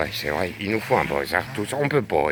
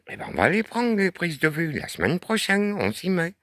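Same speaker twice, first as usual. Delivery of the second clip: high silly voice; 10 semitones higher than usual. Pretend. Cheerful high mock voice.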